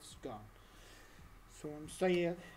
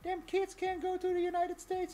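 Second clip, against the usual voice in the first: much higher mock grandma voice, slightly louder; 11 semitones higher, 4 dB louder than usual. Grandma phoning mama about the trip, mock high voice. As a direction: pitch it up to play the grandmother. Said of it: "she was like".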